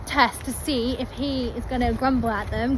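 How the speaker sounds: normal talking voice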